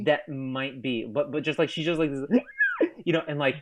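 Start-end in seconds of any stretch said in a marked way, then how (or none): 2.3-3.0 very high-pitched little squeal; imitated excited squeal. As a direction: squeal with excitement like a cartoon character. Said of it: "does this excited squeal".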